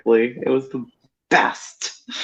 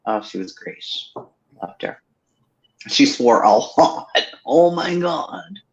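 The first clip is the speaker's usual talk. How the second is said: ordinary talk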